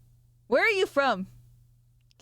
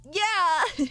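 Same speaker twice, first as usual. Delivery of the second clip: excited; 8 semitones higher than usual. Real, not acted. Excited agreement.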